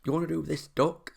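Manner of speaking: a lightly put-on Northern English granny accent; hammed-up Northern English old lady saying hey up duck; Northern English accent, badly faked. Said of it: "was proper northern".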